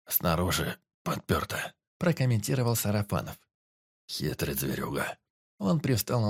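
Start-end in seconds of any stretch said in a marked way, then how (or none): 0.1-1.7 slow, low, fading question; weak forester. A narrator's reading. Dying forester asks the teacher, slow and low. 4.1-5.2 slow, low, fading question; weak forester. A narrator's reading. Dying forester asks the teacher, slow and low.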